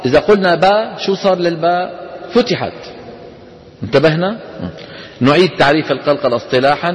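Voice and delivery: normal talking voice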